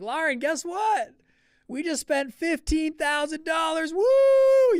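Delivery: high, loud, slow whooping announcement; yelling the big news, thrilled; excited shout. Retold or imitated. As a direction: cheer it down the phone to your fiance. Excited call to fiance.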